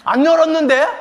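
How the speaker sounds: high, loud, mock-innocent protest; innocent denial. Mother denies opening teen's drawer, high feigned-innocent imitation. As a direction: deny it too quickly, hammed-up.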